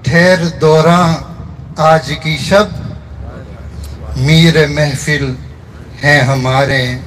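measured mushaira recitation, line by line; poetry recitation. Acted. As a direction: recite the verse in separate phrases, lingering on the refrain. Recites own poem at a gathering. (मुशायरे के अंदाज़ में शायरी)

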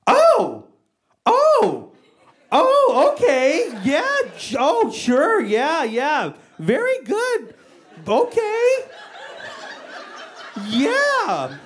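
very high, strained "too nice to say" voice; imitating an uncomfortable, over-polite reaction; mock-polite dismay. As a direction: squeak out fake approval, dragging each reaction. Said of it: "like I gave her a present she didn't like".

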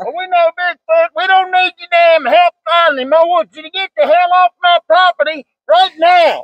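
high-pitched, forceful angry yell; angry confrontation. Real, not acted. Landowner confronting trespassers at their car, angry yelling.

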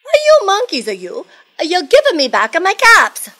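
high, loud acted scolding; comic outburst of peddler temper; angry peddler. Peddler shaking both hands at monkeys, acted anger. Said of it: "This made the peddler angry".

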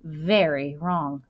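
usual speaking voice

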